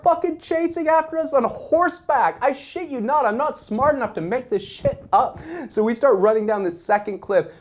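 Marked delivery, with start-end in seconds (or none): none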